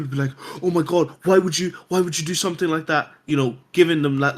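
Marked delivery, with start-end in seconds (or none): 0.6-3.1 imitated shocked reaction, higher pitched; mock an emotional spike of alarm; overreacting. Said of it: "too much of a spike".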